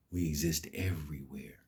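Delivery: soft unvoiced whisper. Real, not acted. Telling viewers Nubians exist everywhere, in a whisper. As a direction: breathe it out like a secret. Whispered emphasis after claim.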